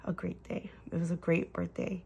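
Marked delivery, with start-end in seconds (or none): 0.1-0.7 whispering. Real, not acted. Whispered aside.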